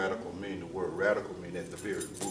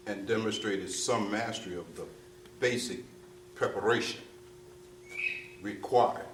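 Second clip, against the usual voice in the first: ordinary delivery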